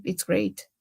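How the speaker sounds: normal talking voice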